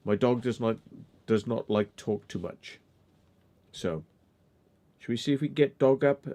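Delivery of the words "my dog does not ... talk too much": normal talking voice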